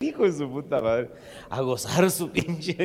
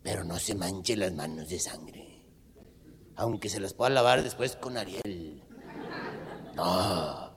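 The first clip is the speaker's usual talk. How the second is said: low, soft, slow confiding voice; hushed secret tip; 10 semitones lower, 7 dB quieter, slower than usual. Acted. Compadre confiding his trick, low and hushed. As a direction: lean in and share a dirty secret.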